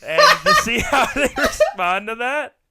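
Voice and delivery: laughing high and loud through the words; cracking up while asking. Real, not acted. Joking about teachers and cocaine, laughing loudly. Laughing mid-sentence at own joke.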